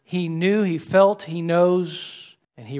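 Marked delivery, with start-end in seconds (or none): none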